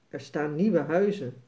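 usual speaking voice

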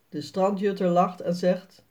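usual speaking voice

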